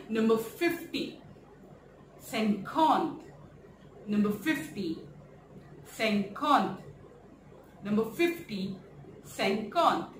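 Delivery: "cinquante" said with nasal vowels, slow; French pronunciation. Shown as a demonstration. Teacher drilling fifty in French. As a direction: repeat cinquante three times. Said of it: "we call it".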